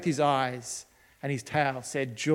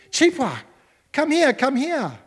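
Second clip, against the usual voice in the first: raised, angry call to a dog, much higher and louder; 9 semitones higher, 3 dB louder than usual. A narrator's reading. Daddy scolds the dog digging up potatoes, angry raised call. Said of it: "I raised my voice and became angry".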